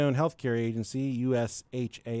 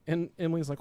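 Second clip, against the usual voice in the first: ordinary delivery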